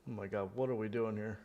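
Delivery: ordinary talk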